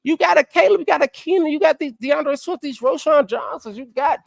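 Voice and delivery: pumped-up pitch to the coach; hyped-up lecture. Acted. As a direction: sell it like it's obvious and easy. Mock-preaching advice to the coach.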